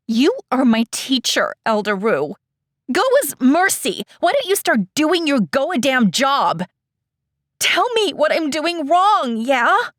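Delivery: loud, high, angry outburst; angry demand. Acted. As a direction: lash out at the teacher. Eda angrily demands from teacher.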